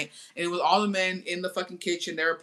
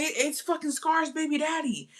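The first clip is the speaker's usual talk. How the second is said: high imitating voice; 9 semitones higher than usual. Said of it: "And he's like".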